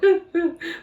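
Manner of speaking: high mock sobs; imitated crying. Babysitter mimics herself crying on the bus, mock sobbing. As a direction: act out little crying sobs, hammed-up. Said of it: "just, like, crying, you know".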